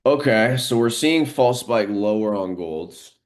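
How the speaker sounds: sudden lift as the news spike hits; excited. Real, not acted. Trader watching the gold chart react to news, excited. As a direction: jumps up high and loud, then slows on the spike.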